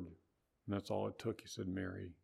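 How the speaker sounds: hushed loving call; tender. Acted. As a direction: say the name gently, with compassion. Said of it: "a lot of sympathy in that word".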